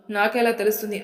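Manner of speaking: ordinary delivery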